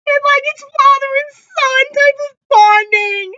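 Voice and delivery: high-pitched, wailing through tears; squeal-cry the words, voice soaring. Real, not acted. Fan overcome by father and son scene, crying. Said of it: "I'm trying not to cry on camera".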